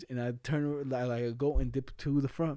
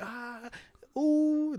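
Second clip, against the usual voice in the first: mock pain yelp; 12 semitones higher than usual. Hit by fly swatter.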